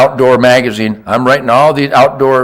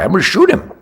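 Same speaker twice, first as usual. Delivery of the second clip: ordinary delivery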